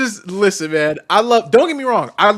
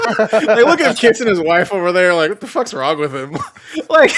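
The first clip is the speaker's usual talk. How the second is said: laughing through the imitation; 3 semitones higher, 3 dB louder than usual. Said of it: "basically going".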